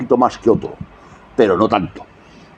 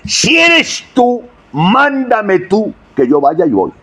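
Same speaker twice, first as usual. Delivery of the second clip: "eres" drawn out high and slow; stress on eres; 11 semitones higher, slower than usual. Shown as a demonstration. Preacher showing Peter's words to the congregation, high and slow. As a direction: lean on "eres tú" slowly and high. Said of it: "Es que es importante eso".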